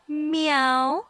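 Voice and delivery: high playful cat meow; kitten voice meow. Pretend. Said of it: "That's my best cat impression".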